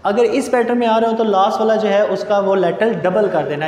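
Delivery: ordinary delivery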